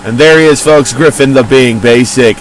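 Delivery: loud booming ring announcer. Acted. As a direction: announce the entrance to the arena.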